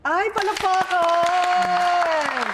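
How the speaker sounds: loud, high, drawn-out call for applause; rally the audience to clap; excited cheer. Real, not acted. Host calling for applause, excited and loud.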